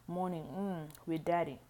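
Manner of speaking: ordinary delivery, nothing marked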